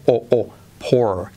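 pour, stretching the O into a long O; slow demo of pour. Shown as a demonstration. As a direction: draw out "pour" as a slow vowel drill. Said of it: "Has añadido una O a la R genérica".

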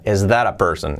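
"that a" linked as "dara" with a tapped T; real English pronunciation. Shown as a demonstration. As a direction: tap the T in "Is that a person?". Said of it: "con inglés real".

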